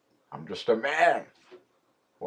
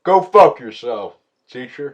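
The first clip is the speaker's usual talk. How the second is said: mock defiant yell; 8 dB louder than usual. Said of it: "I'll say".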